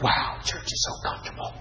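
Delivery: sarcastic whispered falsetto; mock them in a breathy silly voice; mock complacent voice. Preacher mocks a comfortable churchgoer, whispered and sarcastic. Mocking an imagined complacent believer.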